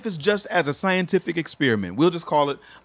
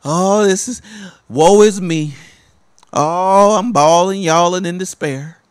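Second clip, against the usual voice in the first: louder, hammed-up wailing lament; mock self-pity; 6 dB louder than usual. Preacher mocks the mother's self-pity, hammed-up loud wail. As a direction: ham up a woe-is-me moan. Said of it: "feeding him that idea that".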